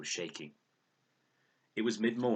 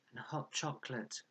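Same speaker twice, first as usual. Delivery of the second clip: murmured sleepily; 8 semitones higher, 4 dB quieter than usual. A narrator's reading. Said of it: "He sounded three-quarters asleep".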